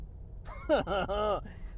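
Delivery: rippling string of ha-has; chuckling laugh. Real, not acted. Podcaster sending listeners on a quest, chuckling laugh. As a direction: let out a long, quick chuckle.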